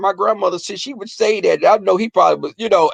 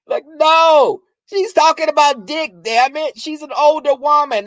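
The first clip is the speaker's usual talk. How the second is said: loud, high, insistent explanation; exasperated protest; 8 semitones higher, 3 dB louder than usual. Retold or imitated. Setting the boyfriend straight about grandma, exasperated. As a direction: hammer it home in mock exasperation.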